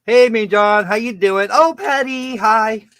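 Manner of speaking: high, loud cheery hello; excited greeting. Real, not acted. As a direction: greet them warmly and loudly. Greeting chat viewers on stream.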